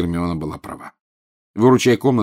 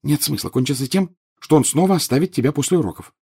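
put-on higher, hushed girl's warning; whispered warning; 6 semitones higher than usual. A narrator's reading. Girl warning her friend about detention, whispering. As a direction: urge him to hold back. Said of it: "прошептала Гермиона".

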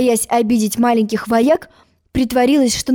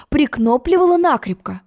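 plain reading voice

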